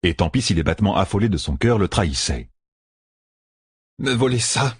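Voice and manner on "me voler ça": mildly grumbled, a little higher; a touch of resentful grumbling. A narrator's reading. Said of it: "grommelait la traqueuse". (légèrement grommelé)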